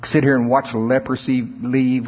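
normal talking voice

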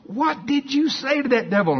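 desperate to be told the secret; pleading. Retold or imitated. Crowd begging the pastor for his secret, mock pleading.

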